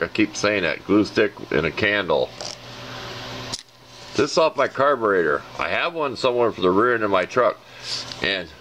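normal talking voice

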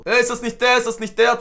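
loud, high mimicked kid's call; cheeky kid. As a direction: imitate a brat calling out loudly. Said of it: "Einfach so frech, ihr wisst doch, Kinder so".

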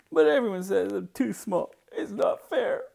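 put-on high, loud little boy's complaint; aggrieved child. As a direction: protest the unfairness in a child's pitch.

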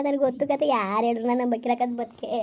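ordinary talk

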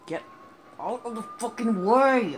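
high, loud furious shout; furious yell. Real, not acted. Gamer yelling at traffic, furious shout. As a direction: scream at the traffic.

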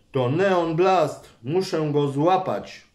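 ordinary delivery

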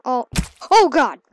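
sudden loud oh God; real shock; startled exclamation. Real, not acted. Reacting to a game crash.

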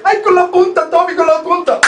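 mock girlish caller answering the DJ; imitating female caller.

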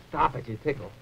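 ordinary delivery

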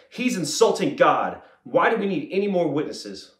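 high, loud indignant outburst; outraged accusation. A narrator's reading. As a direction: denounce him as an outraged high priest. High priest outraged, tears his clothes.

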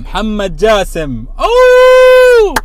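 announcing the winner loudly; excited shout. Real, not acted. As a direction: yell the name, then a big high whoop.

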